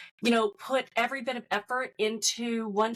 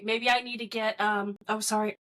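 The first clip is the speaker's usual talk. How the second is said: ordinary talk, nothing marked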